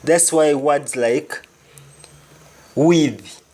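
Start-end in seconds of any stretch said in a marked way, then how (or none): none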